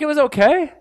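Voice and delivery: high, mildly imitated meh; lukewarm wife. Pastor imitating his wife's shrug about the sermon. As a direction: shrug it off lightly. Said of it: "She's like".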